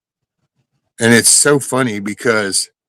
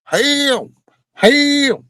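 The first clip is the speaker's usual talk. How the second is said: high-pitched mock cry for help; imitated scream for help; much slower than usual. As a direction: imitate a kid screaming, drawn high above your usual voice. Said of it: "starts screaming".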